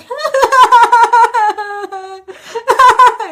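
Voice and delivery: loud, high, long burst of laughter; embarrassingly loud laughing while crying, acted out. Shown as a demonstration. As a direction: demonstrate an uncontrollable laughing fit, very loud. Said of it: "it's so loud".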